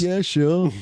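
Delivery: high, loud mock [name] accent; put-on Liverpool Beatle accent. Co-host jumps in with his Beatle, mock [name] accent. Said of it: "get your fucking [name] impression in".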